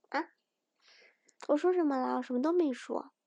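ordinary delivery

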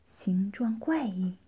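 usual speaking voice

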